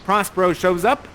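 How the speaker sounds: normal talking voice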